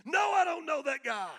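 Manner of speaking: high furious shout; angry cussing denial. Acted. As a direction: yell it, done with the question. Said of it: "Peter's cussing".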